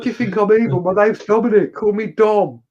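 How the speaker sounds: high, light friendly ghost voice; a hint of a cheery ghost. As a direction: play an innocent little ghost. Playful ghost introducing itself.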